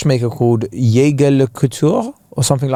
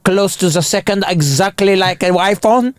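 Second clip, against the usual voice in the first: snooty watch expert; 3 semitones higher than usual. Retold or imitated. Watch-shop staff scold an Apple Watch wearer, mock-offended. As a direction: lecture as an affronted watch snob, stretched out and rising. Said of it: "they look at you like a dickhead. They're like".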